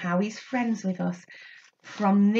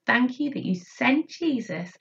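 prayer; 5 semitones lower than usual. Acted. Teacher prays with children.

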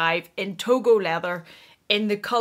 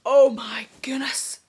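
whispered slowly, a little higher; awestruck whisper; 3 semitones higher, slower than usual. Real, not acted. Vlogger unwraps the bag, whispered slow wonder. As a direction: hush it out in wonder.

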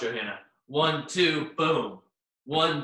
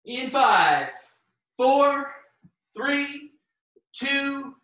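called countdown; 9 semitones higher, much slower than usual. Real, not acted. Coach counts down the class.

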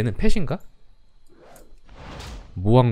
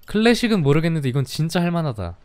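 normal talking voice

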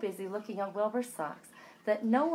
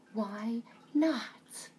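whispering; 2 semitones higher than usual. Acted. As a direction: hush it like a secret. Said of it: "unvoiced whisper".

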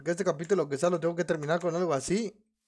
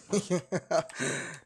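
nervous laughing; short nervous laugh. Real, not acted. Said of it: "Me da risa de nervios". (risa nerviosa)

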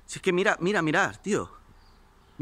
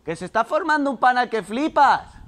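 loud, high call across the house; excited shout; 9 semitones higher, 7 dB louder than usual. Real, not acted. Telling mum about the wasp nest, excited loud shout.

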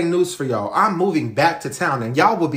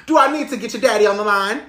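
laughing mock threat; 8 semitones higher than usual. Mom teasing her kids with their dad, laughing. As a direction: taunt them, giggling.

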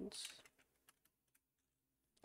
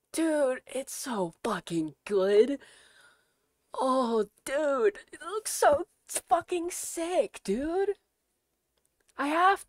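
higher, louder, delighted; excited gushing; 8 semitones higher, 7 dB louder, slower than usual. Real, not acted. Streamer to chat hyped about the sweater, gushing. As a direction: rave about it with a high, louder voice.

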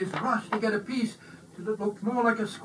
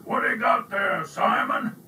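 louder, higher, a gruff bark of a question; barking like a sea lion; 5 semitones higher, 5 dB louder than usual. A narrator's reading. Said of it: "barking like a sea lion".

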